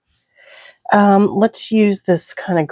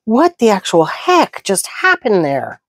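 shocked; 7 semitones higher than usual. Real, not acted. Reacting to stabbing herself.

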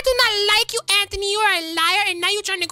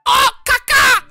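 loud squawking caws like a bird; 4 semitones higher, 5 dB louder, slower than usual. Pretend. Squawking at Merle like a bird, loud. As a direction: screech caca like a dodo bird. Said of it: "What are you, a bird?".

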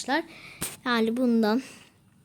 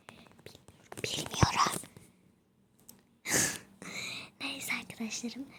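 hushed whisper so dad stays asleep; late-night whisper into the mic; 13 dB quieter than usual. Real, not acted. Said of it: "O yüzden arkadaşlar çok bağırmıyorum".

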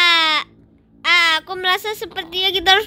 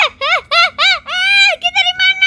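yelling; 8 semitones higher, slower than usual. Pretend. Lost in the game, high panicked yelling. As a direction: yell out lost. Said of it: "jangan teriak-teriak deh".

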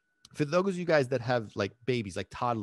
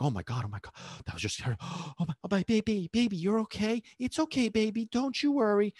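mock fretting that climbs into high cooing; hammed-up worried coddling; panicky fussing; 8 semitones higher, faster than usual. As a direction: fuss over a baby, breathless then high-pitched. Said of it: "You're going into this mode".

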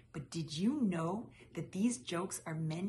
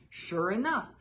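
plain reading voice

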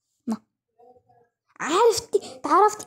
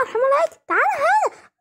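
high yelling; 14 semitones higher, faster than usual. Pretend. Chasing him during play fight.

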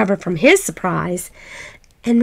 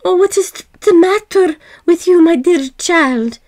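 worried mother; 7 semitones higher, 3 dB louder than usual. A narrator's reading. Mother worried over sad child.

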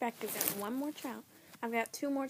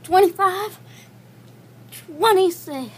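straining, breathless count; doing push ups; 6 semitones higher, 6 dB louder, slower than usual. Real, not acted. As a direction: count out loud through the strain. Push-ups counted during one minute.

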